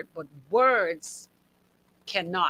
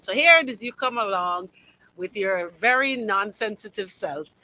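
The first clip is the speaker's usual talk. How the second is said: ordinary talk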